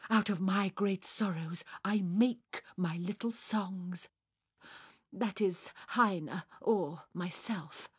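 impassioned declaiming that settles into self-admiring melancholy; tragic Fräulein. Acted. Sonia declaims her sorrows.

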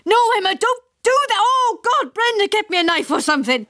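high, frantic pleading; panicked father. A narrator's reading. Father to Emma and Brenda amid ants: frantic, high. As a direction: beg in a panic.

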